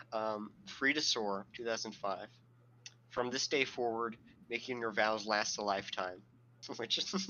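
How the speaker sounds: usual speaking voice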